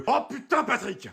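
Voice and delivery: high-pitched outburst at husband; exasperated yell. Acted. Wife fed up with husband's bargaining, yelling at him. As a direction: cut in with an exasperated cry. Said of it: "pas besoin de gueuler".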